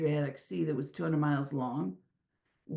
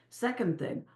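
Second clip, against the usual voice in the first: normal talking voice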